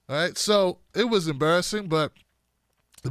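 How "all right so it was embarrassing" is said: usual speaking voice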